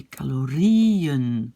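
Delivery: "calorien" slow, with a long stressed RI before -en; accent sur le I. Shown as a demonstration. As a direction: stretch and lift the RI of "calorien". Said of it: "l'accent est sur le I". (« calorien » lent, RI allongé et accentué)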